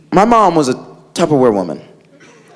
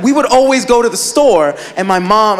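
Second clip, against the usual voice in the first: usual speaking voice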